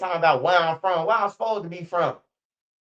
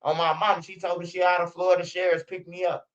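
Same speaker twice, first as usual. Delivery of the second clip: ordinary delivery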